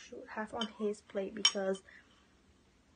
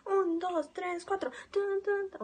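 high, loud hyped countdown; excited countdown; 8 semitones higher, 4 dB louder than usual. Real, not acted. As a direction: pump it up before the bite. Hyping up the countdown.

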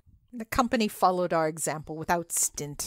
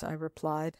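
usual speaking voice

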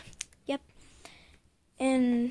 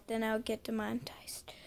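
whispering; 7 dB quieter than usual. Real, not acted. Secretive aside.

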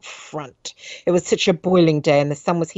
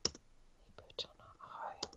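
slow voiceless whisper; 28 dB quieter, slower than usual. Real, not acted. Reader quietly rereads her phrase in a whisper. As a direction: breathe the words out slowly.

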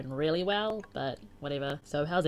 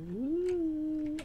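long, high-pitched "ooh"; high cooing "ooh"; 9 semitones higher than usual. Real, not acted. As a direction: let out a rising, drawn-out coo. Cooing at the friend's message.

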